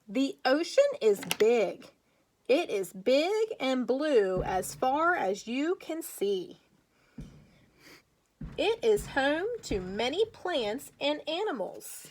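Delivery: put-on slow, clear read-aloud for young kids; reading to children. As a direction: read it slowly, like a picture book for little ones. Teacher reads picture book aloud.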